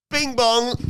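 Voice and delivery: high sung bing bong; imitated entrance chime. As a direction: chime it out like an arena jingle.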